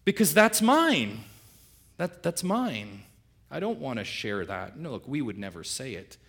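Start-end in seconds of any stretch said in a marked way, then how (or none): 0.1-4.8 slow, high, loud possessive claim; the greedy old man. Retold or imitated. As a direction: grab it back and hold it tight. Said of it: "that thing deep down, which is the old man".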